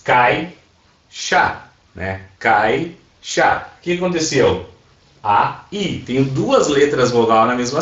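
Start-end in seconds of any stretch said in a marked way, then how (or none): 0.0-1.7 "Cai", pause, "chá"; two separate beats, each syllable on its own; split into syllables. Shown as a demonstration. Teacher dividing a word for students, syllable by syllable. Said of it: "dividi a palavra caixa em sílabas". 2.4-3.7 "Cai" and "chá" as separate beats; split into syllables. Shown as a demonstration. Teacher repeating the divided word, syllable by syllable. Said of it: "dividi a palavra caixa em sílabas".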